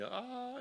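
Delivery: high-pitched mock "ah" of alarm; acted scream. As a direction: cry out as if freaking out at danger. Freaks out seeing rapids.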